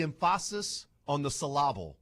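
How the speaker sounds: em-PHA-sis and syl-LA-ble, stress jokingly on second syllables; misplaced stress. Pretend. Host joking about pronunciation, misplaced stress on emphasis and syllable. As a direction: stress "emphasis" and "syllable" wrongly on their middles.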